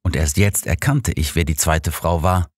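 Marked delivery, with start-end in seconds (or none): none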